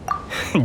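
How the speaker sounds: short, soft airy chuckle; breathy little laugh. Acted. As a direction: laugh off the thanks. Brushing off thanks.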